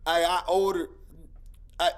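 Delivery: A one Grit character. Acted. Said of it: "he'd be like".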